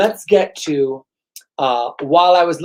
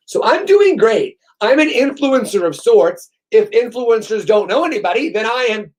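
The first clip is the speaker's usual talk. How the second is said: higher, louder, sarcastic self-praise; mock boast; 6 semitones higher, 4 dB louder than usual. Bragging to viewers about followers, sarcastic mock pride. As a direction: brag ironically, tongue in cheek.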